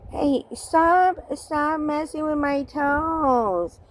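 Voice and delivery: whiny-style retold objection, slow at the end; acted protest. Mimics someone objecting in the story.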